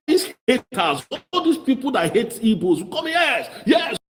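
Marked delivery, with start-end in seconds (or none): 2.9-4.0 high shouted yes, yes; jeering shout of yes; mock cheer. Mocking haters' call.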